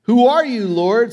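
loud, high, startled cry; a frightened man calling up to heaven; fearful shout to the Lord; Saul crying out. Retold or imitated. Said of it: "Saul asked".

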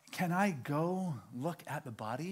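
softly voiced, mildly tearful question; lightly acted grieving request; imitating a tearful neighbour. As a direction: play the upset neighbour asking quietly. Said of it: "she starts crying".

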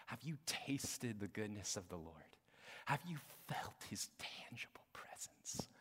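very soft, near-whispered preaching; hushed appeal. Real, not acted. Preacher asking congregation about God's presence, softly and earnestly.

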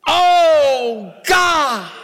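high, loud desperate shout; crying out to God. Pretend. A believer shouting out to God in desperation, loud and high. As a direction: cry out to God with everything.

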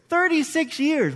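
slow, high "Thirty-six years" with weight on each word; emphatic repeat. Real, not acted. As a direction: let the number sink in.

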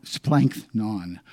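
splanknon drawn out syllable by syllable; careful slow splanknon; slow Greek word. Shown as a demonstration. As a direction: spell out splanknon slowly. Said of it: "I'm gonna murder the pronunciation".

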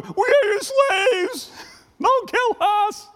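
very high, drawn-out mock wail; hammed-up begging. Brothers begging Joseph for their lives, hammed-up terror. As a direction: plead in a squealing, stretched-out panic.

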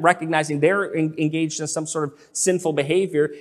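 usual speaking voice

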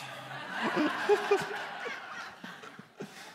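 a breathy laugh that climbs up; high airy laugh. Real, not acted. Preacher laughing at his son's grudging answer, high airy laugh.

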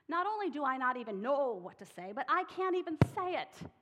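a man begging he can't do it; pleading Moses. Acted. Moses protests his stammer.

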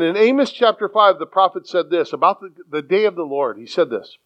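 ordinary talk

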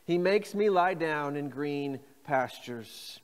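slow, even pace for repeating; slow call and response. Shown as a demonstration. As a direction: lead them slowly. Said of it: "Say that with me".

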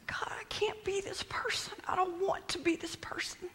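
high, breaking-up whisper; anguished whispered prayer. Acted. Person sitting alone pleading with God, anguished whisper. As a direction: plead in a thin, high hush.

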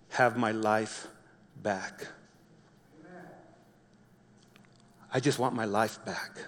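a starving son's hopeless murmur; despairing inner voice. Retold or imitated.

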